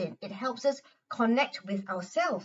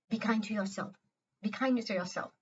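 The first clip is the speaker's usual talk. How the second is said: much softer, kind advice; gentle heart voice; 6 dB quieter than usual. Shown as a demonstration. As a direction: say it like a loving inner voice.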